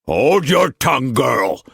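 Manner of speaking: sick uncle crying out at his niece; angry cry. A narrator's reading. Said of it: "Tillman cried".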